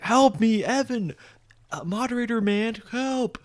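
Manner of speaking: high-pitched imitation of panicked begging; mock desperate plea. Base owner begging the moderator for help, mock desperate plea. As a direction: plead for rescue, hammed up.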